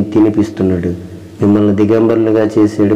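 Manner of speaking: ordinary delivery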